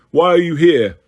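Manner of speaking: loud demanding centaur voice; bellowed. A narrator's reading. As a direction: bellow the question. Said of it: "bellowed the hard-faced grey centaur".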